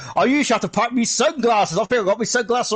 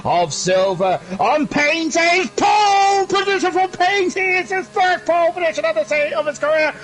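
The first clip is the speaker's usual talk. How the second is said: high, shouting live play-by-play; excited race commentary; 6 semitones higher than usual. Real, not acted. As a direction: call the pole lap at full excitement, pitch way up. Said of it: "Right, I need to calm down".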